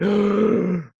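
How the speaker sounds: high, loud gasp of dread; mock horrified gasp. Guest imitates despairing Blues fans, loud gasp. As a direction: suck in air like a dismayed fan. Acts Blues fans' dismay.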